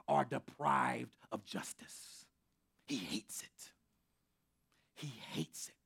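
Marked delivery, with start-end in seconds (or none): 1.3-5.8 hushed. Real, not acted. Hushed intense emphasis.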